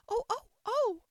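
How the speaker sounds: high, loud cries of pain; screaming. A narrator's reading. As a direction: shriek each "oh". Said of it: "Her screams were so exactly like the whistle".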